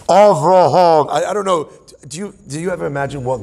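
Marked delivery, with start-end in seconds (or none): 0.1-1.1 loud, drawn-out booming divine call; mock reverb. Angels offering God reverb for his call, mock reverb boom. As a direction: stretch the name out like it echoes through a microphone. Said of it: "Do you want the reverb?".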